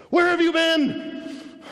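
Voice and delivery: high, loud, drawn-out demand; acted exasperated shout at God; indignant shout. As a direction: cry out to God in protest.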